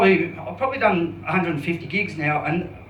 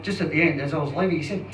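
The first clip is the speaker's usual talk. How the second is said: ordinary delivery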